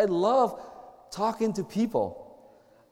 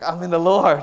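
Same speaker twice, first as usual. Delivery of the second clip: loud declaration; 2 semitones lower, 7 dB louder than usual. Retold or imitated. Brother declaring loudly in public.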